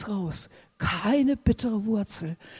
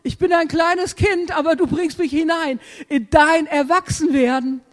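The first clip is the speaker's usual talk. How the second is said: put-on higher, bright little-child voice; higher-pitched childlike confession; childlike prayer; 5 semitones higher, 3 dB louder than usual. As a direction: speak as a trusting small child. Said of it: "dann nehme ich das einfach an und sage".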